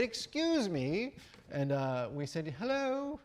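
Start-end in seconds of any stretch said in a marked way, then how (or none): 0.0-1.1 put-on British accent, high and drawn out. British rambler hailing hikers on the hill, mock British accent. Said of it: "this British person came over the hill and said". 2.6-3.2 high, playful mock British greeting; put-on British accent. Hikers greeting the British rambler back, put-on British accent. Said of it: "put on a British accent when I can".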